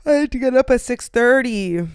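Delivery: tired whining complaint. Real, not acted. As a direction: sound worn out by the early start. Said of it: "I'm tired".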